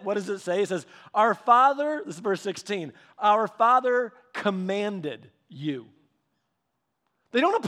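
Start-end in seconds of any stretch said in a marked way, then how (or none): 1.1-2.0 "father" pitched high and loud; loud, raised stress on "father"; emphatic formal quote. Acted. Mock-formal quote against casual "Dad said. 3.2-5.9 "commanded" drawn out slowly and set apart; slow, deliberate stress on "commanded you"; emphatic formal quote. Acted. Repeats the quote to stress wording.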